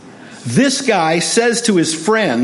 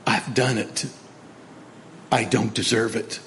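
low, hushed confession, acted; repentant admission; 5 semitones lower, 10 dB quieter than usual. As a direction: admit it softly, pausing between phrases.

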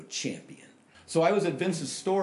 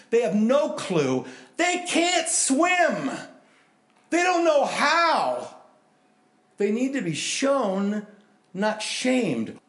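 high, slow, emphatic preaching; impassioned preaching; 8 semitones higher, 4 dB louder, much slower than usual. Acted. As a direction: plead with the congregation at full force. Preaching with rising force.

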